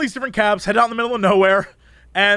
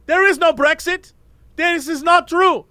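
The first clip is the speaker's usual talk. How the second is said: lightly voiced ranting cab driver, higher; 7 semitones higher than usual. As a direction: imitate the driver's conspiracy rant. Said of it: "He's like".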